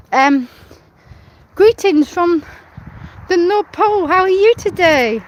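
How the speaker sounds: bubbly North Pole helper; christmas elf. Acted. Cheery North Pole greeting to Santa.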